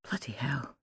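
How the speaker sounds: stunned, very soft whisper. A narrator's reading. Husband hears he was dead in the dream, shocked whisper. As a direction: breathe it out under your breath. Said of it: "he whispered".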